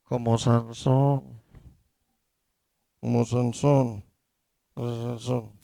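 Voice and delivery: preacher mimicking a believer who caves in; mock defeated Samson. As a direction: sigh it out like someone giving up. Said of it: "nos toca a nosotros decir".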